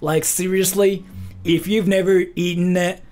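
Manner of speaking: American bro voice. Acted. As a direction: play the clueless American bro. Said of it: "that's what I think Americans sound like".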